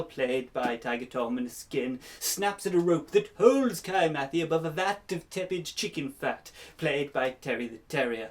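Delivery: breathless cliffhanger serial narrator; melodrama narration. A narrator's reading. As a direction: build the suspense like an old serial.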